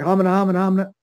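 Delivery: flustered nonsense, lost for words; a dumbstruck kid's stammering mumble; starstruck stammer. Retold or imitated. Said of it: "And I was like".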